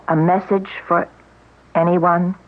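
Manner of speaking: usual speaking voice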